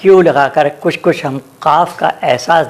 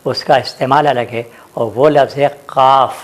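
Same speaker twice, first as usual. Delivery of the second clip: normal talking voice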